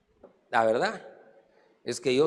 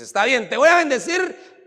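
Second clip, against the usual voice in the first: loud, raised divine promise; emphatic granting; 9 semitones higher, 7 dB louder than usual. Acted. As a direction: announce the blessing forcefully as God. Acts God granting the blessing.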